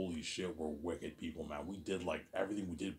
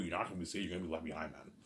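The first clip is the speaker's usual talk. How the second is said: ordinary talk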